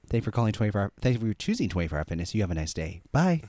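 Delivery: quick, lilting gym sales voicemail; put-on professional phone voice. Shown as a demonstration. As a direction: leave a polished customer-service message. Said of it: "that's my phone voice".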